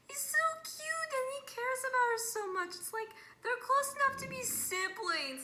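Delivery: high-pitched excited fangirling; adoring gush. Real, not acted. Fan gushing over cute character's bond, excited.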